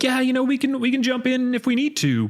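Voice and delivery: very high, laid-back mock voice; timid nerdy voice. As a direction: pitch it way up, meek and easygoing. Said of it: "timid voice".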